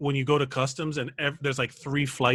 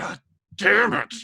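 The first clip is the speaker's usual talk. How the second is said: slow, drawn-out grumbled curse; exasperated agent; 5 semitones higher, slower than usual. Retold or imitated. Fed-up customs agent facing a question, slow groan. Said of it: "It's like".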